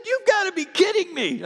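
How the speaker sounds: high, loud shocked disbelief; disbelieving. Retold or imitated. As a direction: squeal it in total shock. Brian shocked by pastor news.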